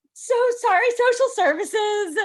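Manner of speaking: high, loud mock apology. Mock apology to social services.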